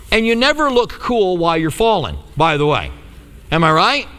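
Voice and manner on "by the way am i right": ordinary talk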